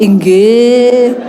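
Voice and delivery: drawn-out, low, compliant yes; obedient wife. Retold or imitated. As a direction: answer like a dutifully obedient wife. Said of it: "Istri yang luar biasa. Melayani papa tuh kayak raja".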